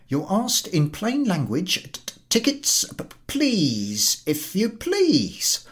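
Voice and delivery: نبرة إصرار متلعثمة; insistent collector. Acted.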